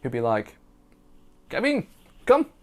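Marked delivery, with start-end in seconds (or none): 1.5-2.5 high-pitched, loud mock beckoning; imitating co-worker. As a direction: call a mate over in a high put-on voice. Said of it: "I can't do Indian accent".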